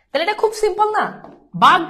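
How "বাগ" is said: বাঘ said as a clipped "bag", then a stop; syllable cut off. Shown as a demonstration. Teacher showing students where to stop, syllable cut off. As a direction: halt on the closing g of বাঘ. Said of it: "এখানে থেমে যাচ্ছ না".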